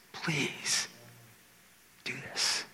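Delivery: hushed, whispered plea; begging. Acted. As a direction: plead in a soft, slow whisper. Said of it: "God is begging us".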